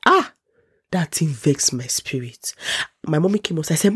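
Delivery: ordinary talk, nothing marked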